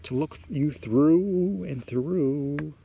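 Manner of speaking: high, slow, drawn-out menace; haunting creature's answer, long held words; spooky creature. A narrator's reading. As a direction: stretch the vowels eerily. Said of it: "the creature answers".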